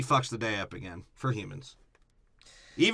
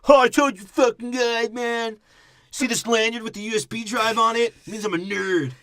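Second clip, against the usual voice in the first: high, loud, hammed-up nerd boast; mock nerd voice; 10 semitones higher, 5 dB louder than usual. Friend mocks a nerdy movie character, high hammed-up boast. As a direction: crow it like a proud nerd holding up his lanyard.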